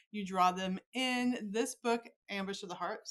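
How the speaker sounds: normal talking voice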